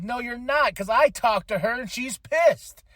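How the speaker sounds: imitating someone firmly calling out a lie; mock-heated contradiction; insistent rebuttal. Acting out a backstage quarrel.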